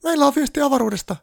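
very high, loud squeal of panic; panicked elf shriek. A narrator's reading. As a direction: shriek it like a frantic little elf. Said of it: "tuli kiljuen sisään".

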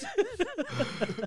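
big high cackle; loud high laugh. Real, not acted. Laughing at a joke.

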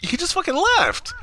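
very high, squeaky retold disbelief; incredulous outburst. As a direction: pitch it way up in appalled disbelief. Retelling disbelief at him driving off.

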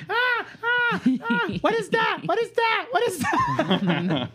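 put-on very high, comic toddler squeal; demanding toddler. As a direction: imitate a small child frantic to see food. Imitates toddler demanding parents' food.

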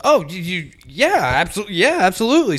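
bright overeager sales pitch climbing up; eager salesman. Retold or imitated.

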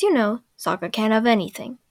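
ordinary talk

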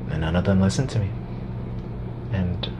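imitating Musk's grim quiet tone; grave Musk tone. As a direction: say it hushed and resigned. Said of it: "He says it with that tone of voice".

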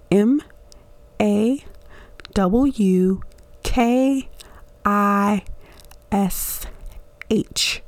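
letter by letter, long pauses between; spelling out slowly. Real, not acted. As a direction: spell it out one isolated letter at a time. Spelling the word again for listeners.

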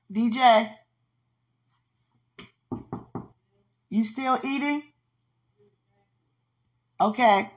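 loud high call across the house. Real, not acted. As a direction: call DJ from another room. Calling out to someone in house.

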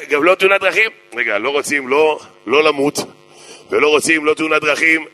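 usual speaking voice

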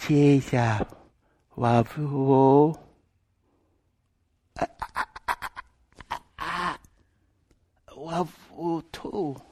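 halting, stammering imitation of her broken English; little boy's first words. Silent little boy finally speaks to her, halting and effortful. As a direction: drag the words out, struggling to get them out. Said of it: "they'd never heard the little boy".

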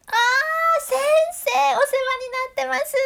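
high, loud put-on phone politeness; sudden company voice, way up high; posh phone voice. Mother greeting the teacher on the phone, high and loud. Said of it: "突然よそ行きになる電話口のお母さん".

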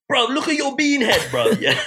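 high, cheeky London roast; cussing in roadman slang; south London accent. Retold or imitated. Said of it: "I was like".

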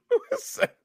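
squeaky laughing question; laughing disbelief. Real, not acted. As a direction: crack up asking it. Laughing at made-up word.

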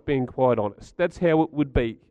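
ordinary talk, nothing marked

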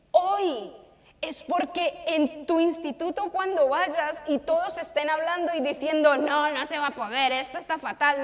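put-on rally the young people with fire; impassioned sermon push; fervent preaching. Preaching climax to youth.

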